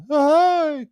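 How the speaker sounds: high, loud put-on greeting; mocking imitation. Streamer mimicking the man he mocks, high imitation voice. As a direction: squeal it out in a mocking voice. Mock Nick voice again.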